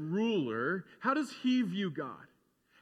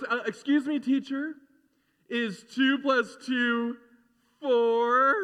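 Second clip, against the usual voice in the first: higher, louder eager teacher's pet; know-it-all student; 5 semitones higher, 5 dB louder, slower than usual. Retold or imitated. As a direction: ham up the show-off pupil. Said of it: "the one person that goes".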